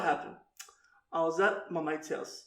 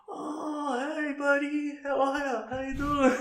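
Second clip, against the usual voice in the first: fake friendly; 8 semitones higher, slower than usual. Fake friendly greeting retold.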